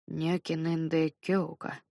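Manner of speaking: Нёкинендекёлка mumbled slowly and softly; Нёкинендекёлка muttered, self-conscious. Acted. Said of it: "пробормотал я".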